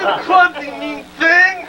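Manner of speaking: put-on angry girl.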